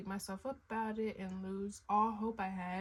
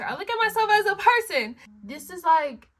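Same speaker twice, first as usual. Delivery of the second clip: loud, high, excited; 6 semitones higher, 11 dB louder than usual. Real, not acted. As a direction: burst out with excitement. Excited about celebrity event.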